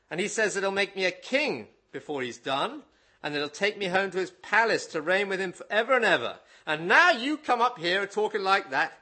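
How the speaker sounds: a lightly put-on Cornish accent, loud and lively; preacher reading Billy Bray in a rough Cornish accent. Preacher to congregation, reading Billy Bray's story in a hammed-up Cornish accent. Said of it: "apologies for not quite getting the Cornish accent".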